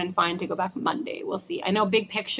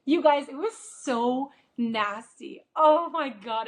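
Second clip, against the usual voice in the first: disgusted; 5 semitones higher, slower than usual. Real, not acted. Recalling the huge green glob.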